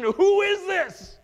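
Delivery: overwhelmed by what they saw; awed fear. Retold or imitated. Shaken disciples asking who Jesus is, high awed fear. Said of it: "They ask the question".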